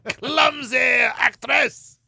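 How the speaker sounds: very slow, loud shouted words. Retold or imitated. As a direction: drag out the insult loudly. Mock director complaint.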